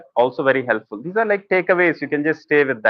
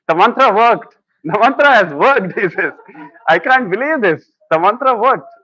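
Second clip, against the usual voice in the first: high, loud, gleeful disbelief; amazed joyful husband, pitched up; delighted husband; 6 semitones higher, 3 dB louder than usual. Retold or imitated. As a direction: burst out happy that it worked. Husband overjoyed, punchline.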